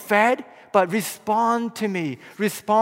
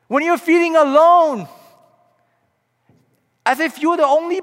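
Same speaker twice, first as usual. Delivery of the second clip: Jesus calling out; 5 semitones higher, 4 dB louder than usual. Acted. Preacher voicing Jesus calling to his listeners, loud and fervent. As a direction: proclaim it like a shout of love. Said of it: "Jesus has been shouting at you".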